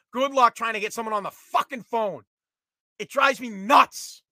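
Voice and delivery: normal talking voice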